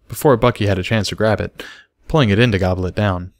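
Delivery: ordinary delivery, nothing marked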